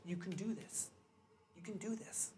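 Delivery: very soft, gentle reassurance; tender encouragement. Retold or imitated. Wife to her crying husband before he leaves, hushed tender encouragement. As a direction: softly coax someone to believe.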